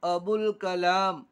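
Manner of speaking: अबुल कलाम read slowly and loudly, syllable by syllable; अबुल कलाम spoken slowly and clearly for pupils; slow demo reading. Shown as a demonstration. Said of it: "बा लाम पेश बुल". (धीरे और ज़ोर से पढ़कर दिखाना)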